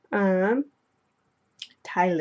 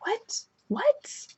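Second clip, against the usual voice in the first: put-on very high, puzzled small-boy squeak; little boy voice. Little brother answering his tugging sister, high-pitched kid voice. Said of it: "And he's just like".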